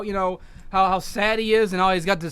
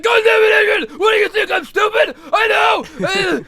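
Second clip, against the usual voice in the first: much louder, higher hammed-up yell; shouting; 10 semitones higher, 7 dB louder than usual. Rocky yelling back at Adrian, hammed-up shout. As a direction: bellow it back like a furious Rocky. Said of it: "And Rocky's like".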